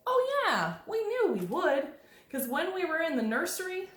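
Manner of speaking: high-pitched, confident "oh yeah"; acted enthusiastic chorus reply; eager children's reply. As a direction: answer brightly up high as the kids. Voices the band children's reply.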